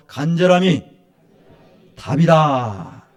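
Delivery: 간절함이 loud, then 답이다 drawn out very slowly; slow emphatic motto. Shown as a demonstration. Pastor leading congregation to repeat, slow and loud. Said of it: "따라 하시죠".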